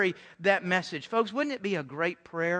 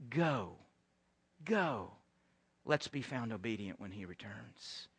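soft, low and hushed; hushed command; 11 semitones lower, 13 dB quieter than usual. Acted. Pastor voicing Jesus' last command to the congregation, hushed. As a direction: lay it down gently like a final charge.

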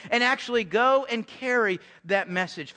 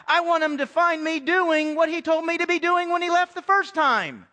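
ordinary talk, nothing marked

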